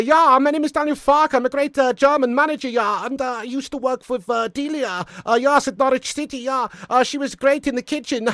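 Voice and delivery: high-pitched German manager with an accent; Daniel Farke impression. Shown as a demonstration. German manager introducing himself, high-pitched impression. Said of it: "how about Daniel Farke?".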